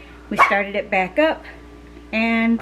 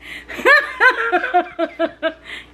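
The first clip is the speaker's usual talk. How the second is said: laughing; 8 semitones higher than usual. Real, not acted. Laughing at herself.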